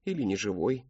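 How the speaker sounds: plain reading voice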